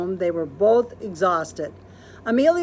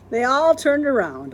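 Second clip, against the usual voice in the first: ordinary delivery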